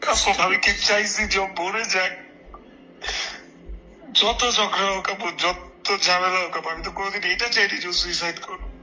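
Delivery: high, tearful and breathless; sobbing out the words in despair; crying on the phone. Real, not acted. Distraught on phone call. (ফোনে কাঁদতে কাঁদতে বলা)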